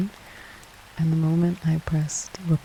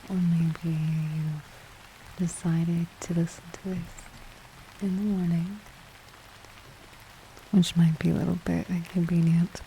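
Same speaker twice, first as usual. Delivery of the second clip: soft ASMR intro; 6 dB quieter than usual. Acted. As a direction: ease listeners in with a hushed voice.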